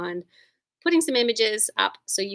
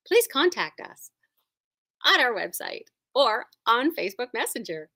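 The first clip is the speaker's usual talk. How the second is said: bright high announcement voice; customer service voice; 3 semitones higher, 3 dB louder than usual. Acted. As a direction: sell it like a cheerful shop clerk. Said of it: "it's my customer service voice".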